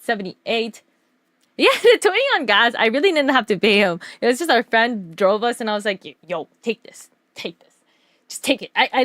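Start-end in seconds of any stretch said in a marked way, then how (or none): 6.3-8.7 hushed, soft mock urging; furtive retold insistence. Retelling slipping gas money to a friend, hushed insistence. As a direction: press it on them under your breath.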